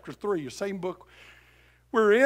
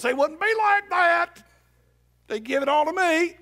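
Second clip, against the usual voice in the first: mock greedy member; 11 semitones higher, 7 dB louder than usual. Preacher mocks a rich churchgoer demanding everything, whiny high shout. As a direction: whine it out like a spoiled rich member.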